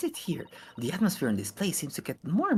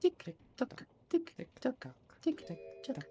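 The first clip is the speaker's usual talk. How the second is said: high sing-song tick tock; clock imitation; 9 semitones higher, 7 dB quieter, slower than usual. Mimics the great clock.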